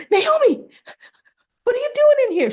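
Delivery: very high, loud mock shriek; startled, caught out. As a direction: imitate a mother caught red-handed, squealing in alarm. Mother caught by daughter.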